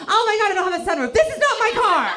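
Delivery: high, loud rush of alarm; panicked realization. Retold or imitated. Driver discovering the wrong car, panicked shouting. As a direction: build to a high shout of shock.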